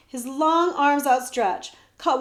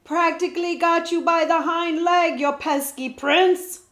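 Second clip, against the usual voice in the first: loud, higher rough hunter's call; shout; 7 semitones higher, 6 dB louder than usual. A narrator's reading. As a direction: crow at the prince from the woods. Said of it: "gave a shout".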